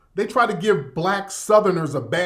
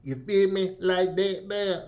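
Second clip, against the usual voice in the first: slurred, softer mock Caribbean-Florida accent; 6 dB quieter than usual. Streamer showing how nobody talked in the South, mock Florida-Caribbean accent. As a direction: imitate that mumbled broken-English Florida accent. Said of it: "those kind of accent".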